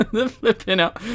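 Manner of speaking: laughing through the words; giggling helplessly mid-phrase; words breaking into laughter. Real, not acted.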